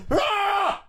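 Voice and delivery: a sudden high yelled cry; imitated yell. Stranger yelling as they enter the square, a high sudden cry. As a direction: belt it out like someone yelling across the park. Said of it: "someone yelled something".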